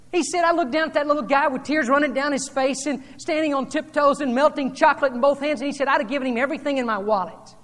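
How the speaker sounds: fervent preacher telling an anecdote; building sermon storytelling. Acted. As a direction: drive the story up to full preaching force. Retelling story at rising force.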